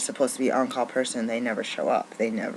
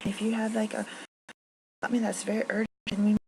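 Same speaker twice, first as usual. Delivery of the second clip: high, sarcastic mimicry of the boss; mock the boss's fake concern; 8 semitones higher than usual. Mocking bosses who demand overtime.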